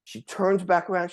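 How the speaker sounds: usual speaking voice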